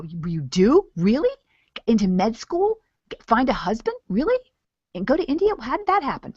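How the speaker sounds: high, loud, incredulous questions; hammed-up disbelief, pitched right up; amazed. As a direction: act out an intrigued listener's astonishment. Acts out prospect's amazed questions.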